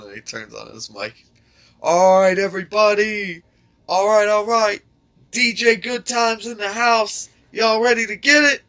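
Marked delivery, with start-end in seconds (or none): 1.8-8.6 imitating a club DJ, much higher and louder; hype DJ. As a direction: pump up the crowd like a DJ on the mic. DJ hyping crowd on mic.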